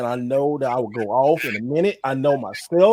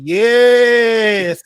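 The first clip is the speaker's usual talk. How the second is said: "Yes" held long, high and loud; drawn-out yes; 7 semitones higher, 12 dB louder than usual. Retold or imitated. Host reads out viewer's comment, "Yes" loud and drawn out. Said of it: "the long S".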